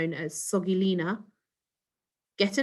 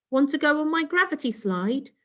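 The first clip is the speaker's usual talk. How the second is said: put-on eager boy; 8 semitones higher than usual. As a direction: show off your rocket keenly. Child inviting guests.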